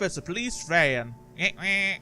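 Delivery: ordinary delivery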